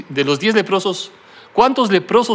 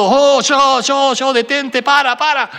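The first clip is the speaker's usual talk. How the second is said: higher, louder panicked cries to stop; desperate shout; 7 semitones higher, 3 dB louder, slower than usual. A narrator's reading. Rider heading for the cliff screams desperately. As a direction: yell in terror at a runaway horse. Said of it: "comenzó a gritar desesperado".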